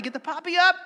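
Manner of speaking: ordinary talk, nothing marked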